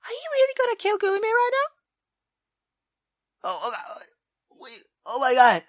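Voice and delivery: ordinary delivery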